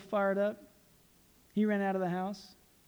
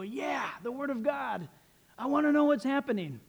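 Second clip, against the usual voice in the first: higher, stretched-out eager cry; eager believer; 6 semitones higher than usual. Acted. Preacher voices a believer keen for Revelation, eager. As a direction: play a keen listener, voice leaping up.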